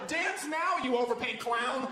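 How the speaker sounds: high, jeering taunt from the stands; mock the injured player from the stands; gloating fan heckle, high and drawn out. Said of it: "you see me in the stands going".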